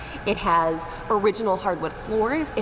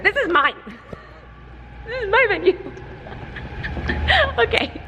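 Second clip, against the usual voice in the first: excited squeal; 12 semitones higher than usual. Real, not acted. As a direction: squeal with joy over the venue. Overjoyed over her own venue.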